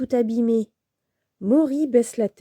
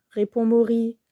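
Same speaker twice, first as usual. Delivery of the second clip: ordinary delivery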